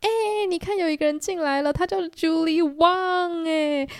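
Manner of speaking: excited and loud. Retold or imitated. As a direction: announce the newcomer like a host, drawn out. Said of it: "我就很兴奋，而且很大声的说". (很兴奋又大声地拉长招呼)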